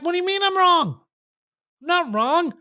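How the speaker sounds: high-pitched indignant pushback; defensive. Acted. Person told they're wrong pushes back, defensive. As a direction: bristle and deny it, voice shooting up. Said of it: "they'll get defensive".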